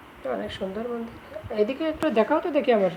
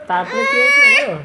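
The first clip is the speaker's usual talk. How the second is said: very high, loud storyteller squeal; dramatic storytelling squeal; 10 dB louder than usual. Acted. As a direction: squeal it like a thrilling tale. Mother starts a story to child.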